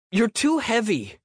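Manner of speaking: put-on high whiny kid voice; complaining boy. A narrator's reading. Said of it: "he complained".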